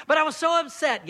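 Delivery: self-justifying, pushed up in pitch; protesting excuse. Acted. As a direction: act out someone pleading an excuse. Acting out a second excuse.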